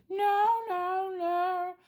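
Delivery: very high wailing howl of no; imitating a dog crying no. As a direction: howl it like a stubborn husky. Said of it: "And he".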